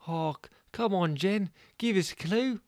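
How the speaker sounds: high, stammering plea; high voice begging for a hint; Mudguard pleading. Acted.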